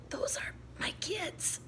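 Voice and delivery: high, soft scratchy whisper. Acted. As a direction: rasp it out in a thin whisper. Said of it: "she said in a scratchy voice".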